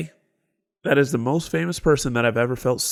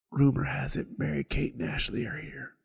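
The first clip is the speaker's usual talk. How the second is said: soft, hushed gossip; whispering rumor-monger; 7 dB quieter than usual. Retold or imitated. Customer shares a celebrity rumor with the worker, whispering. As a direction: pass it on like a secret. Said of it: "Why are we whispering?".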